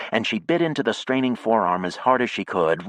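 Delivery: ordinary delivery, nothing marked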